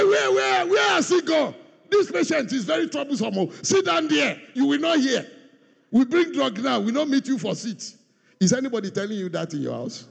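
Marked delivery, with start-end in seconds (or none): 0.0-8.0 mock nurse scolding a troublesome patient. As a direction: play the fed-up nurse chasing a patient.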